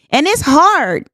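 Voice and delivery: emotional. Real, not acted. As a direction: voice rising with real emotion. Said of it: "I'm getting emotional just talking about it".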